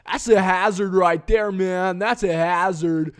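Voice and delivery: high, loud, drawn-out stoner voice; hammed-up laid-back dude; imitating The Illusion. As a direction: play the dazed bowl-cut guy fussing over a nick. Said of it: "He's like".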